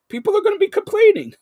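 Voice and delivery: high, loud, fast mock panic; mock alarm. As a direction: squeal it like a pretend-outraged viewer. Host teases driving co-host.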